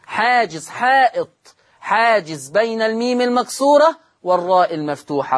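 ordinary talk

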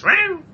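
high squeaky meow; cat meow. Pretend. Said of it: "That was a cat sound".

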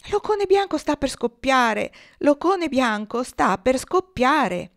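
loud, slow urgent warning; alarmed shouting. A narrator's reading. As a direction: call the warning out.

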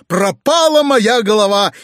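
high, loud outburst; despairing cry. A narrator's reading. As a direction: cry out as if all is lost. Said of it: "вскрикнул дядя". (отчаянный крик)

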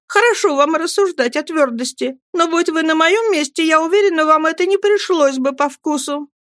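put-on high, protesting young wife; mother crying out. A narrator's reading. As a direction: argue back in a high, hurt voice. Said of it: "закричала матушка". (высоким голосом, обиженно возражая)

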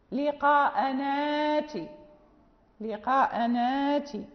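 لقاء ناتي drawn out slowly, the hamza turned into a long alif; Quran recitation example. Shown as a demonstration. Teacher reciting slowly to the students. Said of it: "مثال الفتح هو هذا".

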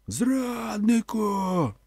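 crowd shout. A narrator's reading. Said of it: "дружно озивалися вони".

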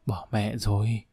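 hushed, dismayed undertone; hushed whisper. A narrator's reading. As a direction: confide it under your breath, covering the phone. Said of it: "Thúy Bình bịt máy thì thào với Đức".

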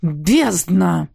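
exclaimed oath from a dragon; angry curse; cursing. A narrator's reading. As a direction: swear under strain, in character. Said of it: "выругался дракон".

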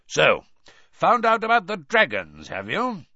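lightly voiced gruff old teacher, punching the question; gruff Moody. A narrator's reading. Moody questioning Harry about the dragons, gruffly knowing.

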